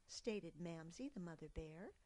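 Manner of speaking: ordinary delivery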